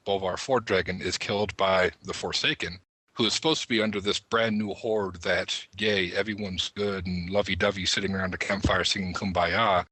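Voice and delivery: usual speaking voice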